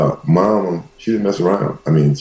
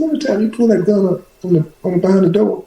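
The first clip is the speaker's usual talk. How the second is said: retold question to mama; faster than usual. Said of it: "I said".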